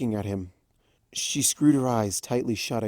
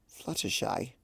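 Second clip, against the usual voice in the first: concerned; 10 dB quieter than usual. Acted. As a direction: say her name gently, worried. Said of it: "obviously concerned".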